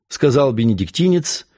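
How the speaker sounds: ordinary delivery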